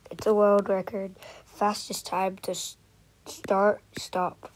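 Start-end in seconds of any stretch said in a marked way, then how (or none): none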